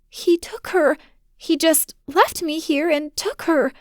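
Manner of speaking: put-on high, tearful child's voice breaking; a frightened girl about to cry; cracking voice. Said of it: "her voice cracking".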